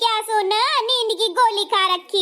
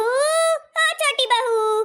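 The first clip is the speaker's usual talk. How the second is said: long, drawn-out, higher call across the house; 6 semitones higher, much slower than usual. Acted. Mother-in-law calling the younger bahu from afar, drawn-out higher call. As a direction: stretch it out to reach the other room.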